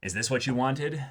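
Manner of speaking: usual speaking voice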